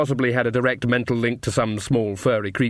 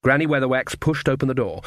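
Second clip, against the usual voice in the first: plain reading voice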